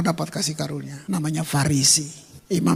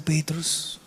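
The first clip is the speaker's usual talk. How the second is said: tender hushed question; softly whispered Petrus; whispered name; 5 semitones lower, 7 dB quieter than usual. Acted. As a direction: breathe Petrus without voice. Jesus asking tenderly.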